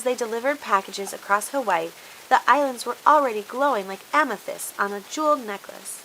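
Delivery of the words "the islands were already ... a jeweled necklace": ordinary delivery, nothing marked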